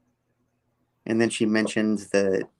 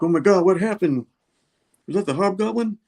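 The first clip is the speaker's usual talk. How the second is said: higher-pitched, astonished questioning; mock shocked reaction; 9 semitones higher, 4 dB louder than usual. Bystanders asking what happened to Norman, mock shocked. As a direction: retell it as a startled, high-pitched gasp of disbelief. Retold alarmed reaction to injury.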